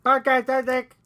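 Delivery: high strange alien Podcast Addict. Pretend. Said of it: "that was my alien impression".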